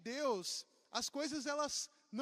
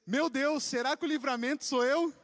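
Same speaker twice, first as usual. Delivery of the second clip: mock worried; 2 semitones higher, 7 dB louder than usual. Meme voice, single person worrying.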